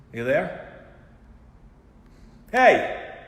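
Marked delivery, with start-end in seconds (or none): 2.5-3.2 shouting hey to get his attention; sudden loud hey; loud call. Acted. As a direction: call out sharply across the room. Calling out to unresponsive puppet.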